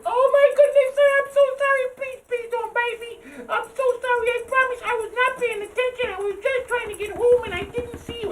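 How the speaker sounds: very high squeaky pleading mouse; tiny mouse voice. Acted. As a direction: squeak out an apology. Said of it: "the little mouse said".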